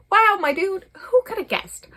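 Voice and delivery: high, loud mock sarcasm; sarcastic friends. Friends mocking him for finally realising his love, loud high sarcasm. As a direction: tease him like a smug buddy.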